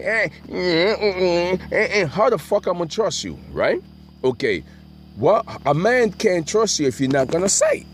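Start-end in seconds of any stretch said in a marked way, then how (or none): 0.0-3.4 high-pitched mock mumbling into words; imitating the guy. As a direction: mimic him with high grunts and hums. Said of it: "so he coming out going".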